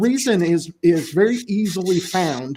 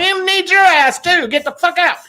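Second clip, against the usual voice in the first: defiant Tonto; 13 semitones higher, 4 dB louder than usual. Retold or imitated. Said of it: "he's like".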